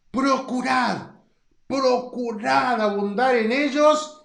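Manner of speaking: slow, drawn-out stress on "Procurad"; emphasized for clarity. Shown as a demonstration. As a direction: lean hard on each "procurad" to drive it home. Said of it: "te lo voy a remarcar para que quede claro".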